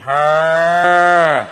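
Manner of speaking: drawn-out low moan, loud; slow-motion orgasm groan; loud dragged-out ah. Retold or imitated. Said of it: "'cause it's like".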